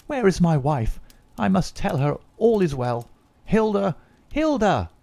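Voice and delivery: a husband calling his wife's name. Acted. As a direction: call up the stairs for Hilda. Running off calling his wife.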